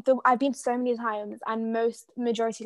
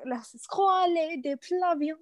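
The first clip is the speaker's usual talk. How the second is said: mock French; 5 semitones higher, slower than usual. Mock French title aside.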